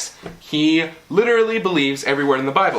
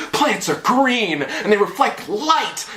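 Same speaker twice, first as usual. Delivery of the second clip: imitating Bill Nye; 7 semitones higher, slower than usual. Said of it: "he was like".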